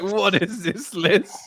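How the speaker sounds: mock incredulous, laughing. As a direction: drag it out in disbelief. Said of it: "I was like".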